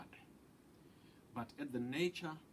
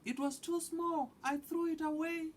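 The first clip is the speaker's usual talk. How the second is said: put-on high, louder child's voice, arguing back; a kid defending himself, pitched way up; 9 dB louder than usual. A narrator's reading. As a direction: grandson arguing with grandfather, high and insistent. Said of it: "I argued".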